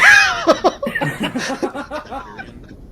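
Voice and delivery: high, loud laughing fit; burst of laughter. Real, not acted. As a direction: break into laughter. Laughs after accent joke.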